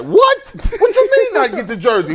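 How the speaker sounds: indignant disbelief. Acted. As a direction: play the stunned player protesting, half laughing. Acting out player losing his jersey.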